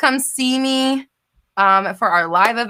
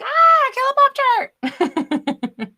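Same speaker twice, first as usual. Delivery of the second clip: high, loud squeal of pretend recognition; mock excited scream; 5 dB louder, much slower than usual. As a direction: play-act shrieking at spotting a famous friend. Acting excited recognition of screen name.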